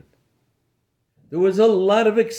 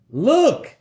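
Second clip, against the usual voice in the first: calling out; 9 semitones higher than usual. Acted. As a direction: call out to everyone in amazement. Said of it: "he called out to everyone".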